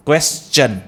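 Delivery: क्वेश्चन with a clear "chun" ending; correct pronunciation. Shown as a demonstration. Teacher repeating the option for students, correctly pronounced. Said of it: "चन होगा".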